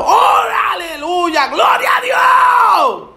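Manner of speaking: high, drawn-out hallelujah shout; shouted praise. Acted. Believer praising God through a crisis without money, shouting. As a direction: cry out praise at full voice. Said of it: "Gritar más duro".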